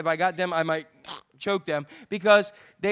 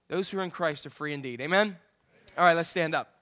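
usual speaking voice

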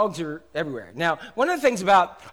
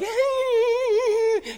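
high excited dog yelp. Retold or imitated. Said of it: "she's like".

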